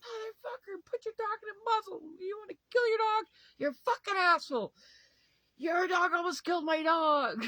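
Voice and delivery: screaming high and loud at the neighbor; reliving the blowup, yell it high and hard; furious yelling. Retold or imitated. Retelling yelling at the dog owner, a high furious scream. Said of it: "I'm like".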